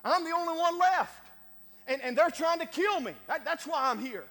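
loud, high, desperate pleading to the Lord; Elijah pleading. Acted. Elijah defending himself to God, loud desperate plea. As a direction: beg your case, voice rising and straining. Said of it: "Elijah says".

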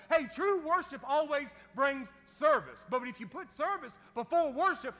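loud, high, fervent preaching; shouting sermon to the congregation at its peak; preaching at full force. Acted. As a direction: whip the church up, calling out.

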